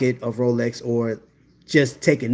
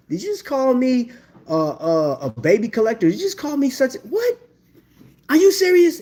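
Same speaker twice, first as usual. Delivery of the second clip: hammed-up indignant retort; mock outrage; 11 semitones higher than usual. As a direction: play offended disbelief at a rude comment. Acted back-and-forth with a critic.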